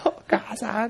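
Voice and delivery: 高い声で母親を呼ぶ子供のふり; 夜泣きに乗っかって呼ぶ; ふざけた子供声. Pretend. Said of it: "トメさんも乗っかんないですか？". (ふざけて子供のように高く)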